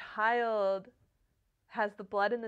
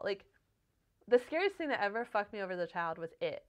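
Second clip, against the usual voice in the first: ordinary talk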